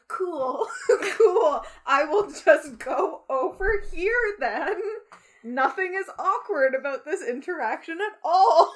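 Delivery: laughing through a mock polite retreat; awkward laughing brush-off. Friend joking at an awkward moment, laughing. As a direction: play it cool while cracking up. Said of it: "and you're like".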